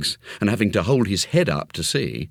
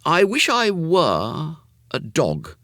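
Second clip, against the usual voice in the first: high, slow corrected wish; boy Jimmy wishing; 10 semitones higher, slower than usual. A narrator's reading. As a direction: say the wish carefully, slowly. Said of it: "said Jimmy".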